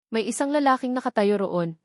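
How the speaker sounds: plain reading voice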